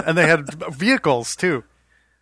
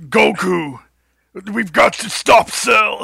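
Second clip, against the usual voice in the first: slow, much higher and louder shouted hero voice; overacted dub hero; 6 semitones higher, 8 dB louder, much slower than usual. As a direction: yell it like a cheesy cartoon hero. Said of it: "In the U S".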